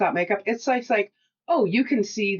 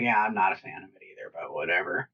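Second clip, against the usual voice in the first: dismissive agreement; 12 semitones lower, 5 dB quieter than usual. Retold or imitated. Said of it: "I was like".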